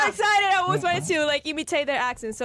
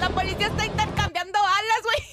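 higher, excited Spanish translation, laughing at the end; panicked reporter; 6 semitones higher than usual. Retold or imitated. Translating the reporter's panic for her friend, higher and excited. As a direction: act out the reporter freaking out. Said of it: "Wait, what'd he say?".

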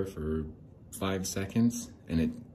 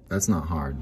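ordinary delivery

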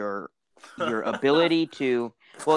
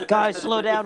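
laughing call; 9 semitones higher, 3 dB louder than usual. Acted. Laughing call in Mars bit.

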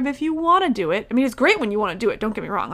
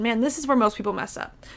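ordinary delivery

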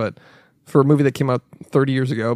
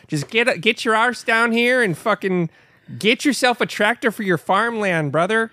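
higher-pitched local tractor pitchman; mock Minnesota ad; 6 semitones higher than usual. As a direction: sell it like a hammed-up regional commercial. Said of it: "I would get all these ads".

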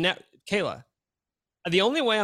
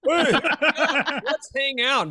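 mock cheery invite; 8 semitones higher, 6 dB louder, much slower than usual.

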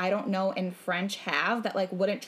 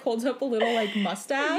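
ordinary talk, nothing marked

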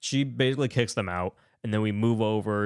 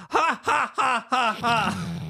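high, forced staccato cackle; horrifying child laugh; 11 semitones higher, much slower than usual. Retold or imitated. Child in the back seat laughing, horrifying mock cackle. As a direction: imitate a horrible kid's laugh, far above your own voice. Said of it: "like, horrifying laugh that you would".

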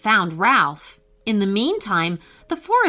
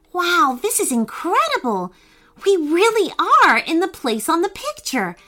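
high, eager little sister's cry; excited; 9 semitones higher than usual. A narrator's reading. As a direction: marvel at the new world, voice up high. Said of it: "cried Brooke excitedly".

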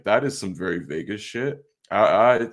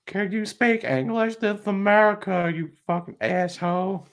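mock Karen; 11 semitones higher than usual. Mocking the Karen hotel guest.

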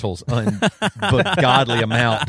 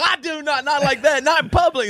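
much higher, laughing self-defence; mock protest; 14 semitones higher than usual. Retelling his reply to his wife, high mock protest. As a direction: squeak out an indignant denial. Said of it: "And I go".

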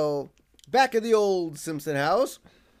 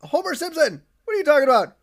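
baffled Burns; 8 semitones higher, 7 dB louder than usual. Retold or imitated. Burns voiced, baffled and shouting.

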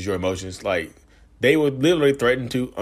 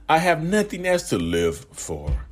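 slow, higher, drawn-out plea; dramatic guilt trip; 7 semitones higher, slower than usual. Shown as a demonstration. Narcissist milking the threat to stay, slow higher plea. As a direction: stretch it out to guilt them. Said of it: "They'll say it just like that".

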